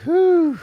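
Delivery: a big, high, loud exhale of relief; loud whew. Retold or imitated. Golfer on a hot course, huffing a loud high whew.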